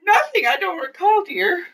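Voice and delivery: high, teasing fake-innocent denial; mock innocence. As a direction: play dumb sweetly to needle him. Wife teasing husband about boring.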